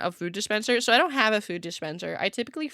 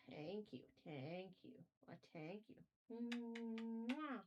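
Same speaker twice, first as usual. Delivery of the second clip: very soft, high cooing to cat; talking softly to cat; 4 semitones higher, 18 dB quieter than usual. Real, not acted. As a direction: coo gently to the cat. Soft baby talk to cat.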